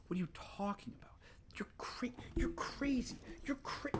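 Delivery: high, fast whispered complaint; disbelieving whisper. Real, not acted.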